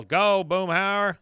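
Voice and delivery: slow, loud, high cheer; acted rallying yell; cheering shout. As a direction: draw it out like a rooting fan. Acting out Dale cheering him on.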